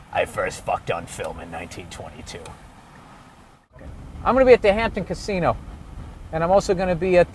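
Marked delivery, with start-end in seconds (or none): 0.1-2.4 much lower, softer, far-off interview voice; a worn-out star recalling the old days. Pretend. Porn star in mock documentary.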